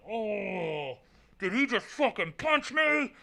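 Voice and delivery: high, held-back cry of disbelief; stunned disbelief. Retold or imitated. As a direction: relive the shock in a high, strained voice without volume. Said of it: "I just remember doing this. I was like".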